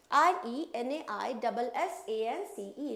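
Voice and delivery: slow letter-by-letter spelling; spelled out. Shown as a demonstration. Teacher dictating the spelling slowly. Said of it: "spelling renaissance again".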